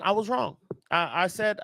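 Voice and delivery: ordinary talk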